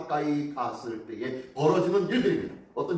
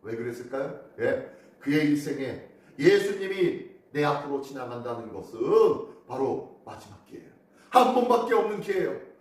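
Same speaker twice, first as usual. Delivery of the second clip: normal talking voice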